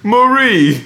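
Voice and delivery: high, loud hammed-up name call; exaggerated high, loud imitation; mock cry. Mocking the failed accent.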